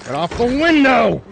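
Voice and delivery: high, loud angry shout at the window; furious shout; heated yell. Real, not acted. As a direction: bellow at them to get off. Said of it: "I'm getting heated".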